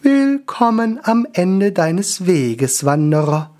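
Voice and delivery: high, very slow solemn angel; high slow angelic greeting. Acted. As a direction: draw it out like a serene angel. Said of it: "grüßte der Engel".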